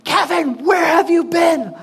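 sharp, loud, high shout of his name and the question; retold yelling. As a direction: bark it out like an angry bridesmaid. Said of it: "starts yelling".

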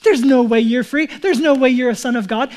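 loud sneering jeer; mocking taunt. Enemy mocking the believer, loud sneering taunt. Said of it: "they'll make fun of you".

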